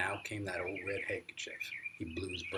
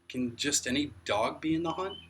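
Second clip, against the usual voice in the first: croaked; 6 semitones higher, 7 dB louder than usual. A narrator's reading. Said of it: "I croaked".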